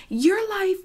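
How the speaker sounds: high-pitched mock complaint; mocking imitation. Imitating opponent in retold quarrel.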